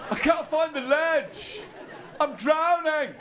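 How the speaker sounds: high, loud, stretched cries for help; mock panicked swimmer. Irish swimmers two-thirds down the pool, hammed-up panicked wail. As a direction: wail it high, as if going under. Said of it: "and then they go".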